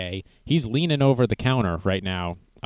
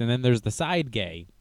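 ordinary talk, nothing marked

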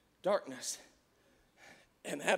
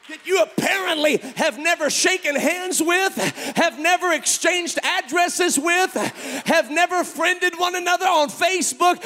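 loud, higher, hammering each line; preaching at full force; 11 semitones higher, 12 dB louder than usual. Acted. Preacher shouting the crowd awake about isolation. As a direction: drive it home to the congregation.

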